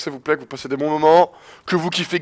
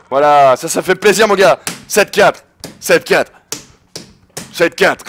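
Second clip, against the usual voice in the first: celebrating; 4 dB louder, slower than usual. Real, not acted. Gamer celebrates lead.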